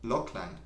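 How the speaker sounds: Lachlan as LOCK-lən, with a rounded "o"; corrected pronunciation. Shown as a demonstration. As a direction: try out Lachlan starting like "lock". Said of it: "you pronounce it like".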